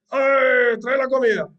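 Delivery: loud, high demanding yell at the wife; rude shout. Retold or imitated. Yeshiva boy yelling at his new wife, loud and high. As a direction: bark the order across the room like a yeshiva boy. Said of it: "y el bojer dice".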